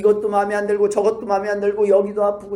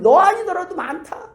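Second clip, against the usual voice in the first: teasing voice of God; 9 semitones higher, 6 dB louder than usual. Acted. Preacher voicing God's teasing.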